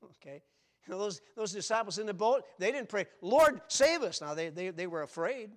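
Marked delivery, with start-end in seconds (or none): 3.2-4.2 loud, high plea for rescue; crying out. Acted. Preacher voicing a disciple's cry from the boat, loud and high. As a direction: call out urgently, pitched well up. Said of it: "Peter's the one who cried that".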